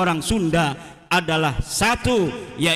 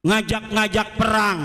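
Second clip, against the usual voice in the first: ordinary talk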